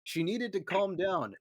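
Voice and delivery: ordinary talk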